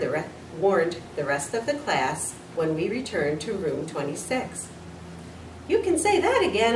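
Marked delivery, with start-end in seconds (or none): 5.7-6.8 high squeak of a hamster; tiny hamster squeak; squeaky. A narrator's reading. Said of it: "I squeaked".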